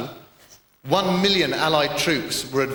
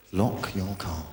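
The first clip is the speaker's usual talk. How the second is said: softer, lower repeat of lock your car; quieter echo; 6 semitones lower, 6 dB quieter, slower than usual. Retold or imitated. Comic mocking an advert, fading echoed slogan. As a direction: let the slogan fade like an echo. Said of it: "telling us".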